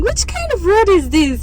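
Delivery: high whiny complaint; complaining kid. Retold or imitated. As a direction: whine like a fed-up kid. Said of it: "Abel has been complaining".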